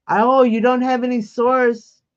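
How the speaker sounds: ordinary delivery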